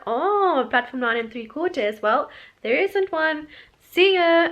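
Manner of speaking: breezy and dismissive, ending on a loud "See ya"; mock callous send-off. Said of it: "They were just like".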